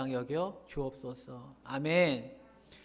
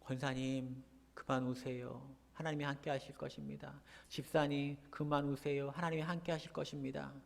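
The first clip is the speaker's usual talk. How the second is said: quiet consolation; 3 semitones lower, 10 dB quieter than usual. Retold or imitated. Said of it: "사람은".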